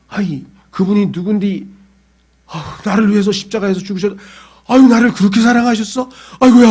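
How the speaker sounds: rising into a loud teary gush; weepy gushing. Retold or imitated. Emotional believer thanking Jesus, mock teary gush. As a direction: play a Korean believer moved to tears. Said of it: "하면서 막 눈물 콧물 흘리면서".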